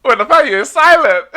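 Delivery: high, loud crowing; teasing jab. Real, not acted. Teasing the rival fan for going silent, loud gleeful jab. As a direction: call him out loudly, pitch leaping up.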